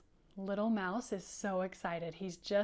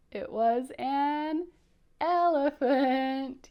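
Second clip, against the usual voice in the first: slow, louder, higher "elephant"; teacher reveals the elephant; dramatic reveal; 5 semitones higher, 8 dB louder, much slower than usual. Acted. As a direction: draw out the surprise. Big reveal in picture book.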